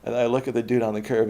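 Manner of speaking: ordinary talk, nothing marked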